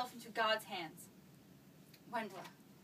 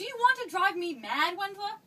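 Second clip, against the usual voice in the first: loud, higher cry of alarm; distraught outburst; 7 semitones higher, 7 dB louder than usual. Acted. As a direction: burst out in frantic dismay. Mother cornered by daughter's questions.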